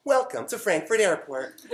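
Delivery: mock German airport announcement; lightly voiced loudspeaker announcer. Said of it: "they do the translation in English".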